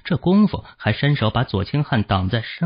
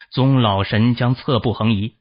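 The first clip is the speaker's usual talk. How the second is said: usual speaking voice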